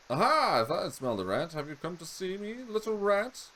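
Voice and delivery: high, gloating sister voice, loud burst at the start; creepy sister. Acted. Sister taunting her brother as a little rat, high and gloating. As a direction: taunt him as the sneering sister.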